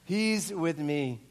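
drawn out at normal volume; slow, weighty repeat. Retold or imitated. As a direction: let each word land slowly.

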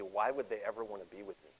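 low, hushed and doubting; a soft inner voice full of shame; quiet self-doubt. Retold or imitated. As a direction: murmur it as if ashamed. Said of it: "the logical thing to think would be".

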